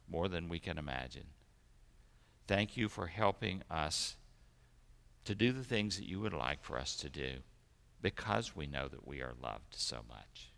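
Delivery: low, hushed praying voice; prayer. Real, not acted. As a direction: pray softly and low, giving thanks to God. Leads the children in prayer.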